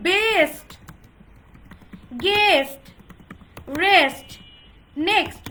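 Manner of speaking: "বেস্ট, গেস্ট, রেস্ট, নেক্সট" with long closed "ay"; loud, drawn-out বেস্ট, গেস্ট, রেস্ট, নেক্সট; wrong vowel sound. Shown as a demonstration.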